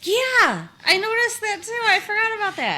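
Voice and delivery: high, loud burst of sudden recognition; excited recognition. Real, not acted. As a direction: blurt it out as it suddenly clicks. Suddenly recalls the shirt detail.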